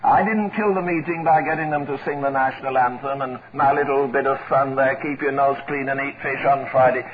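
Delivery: put-on low-pitched, unhurried reply of an old preacher; low, dry quoted preacher; Nicholson. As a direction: drop the voice well down, matter-of-fact. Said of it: "Brother Nicholson".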